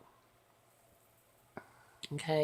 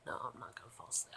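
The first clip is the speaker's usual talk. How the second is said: hushed rush; 5 semitones lower, 11 dB quieter, much faster than usual. Real, not acted. Quick drowsy denial.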